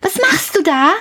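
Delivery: put-on high child's voice calling upward; a curious boy shouting up a tower; calling up as Häwelmann. A narrator's reading. Häwelmann calls up to the rooster, high and curious. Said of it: "rief der kleine Häwelmann hinauf".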